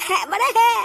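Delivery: awkward laugh. Acted.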